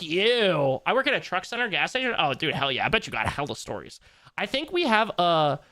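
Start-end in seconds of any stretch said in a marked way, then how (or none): none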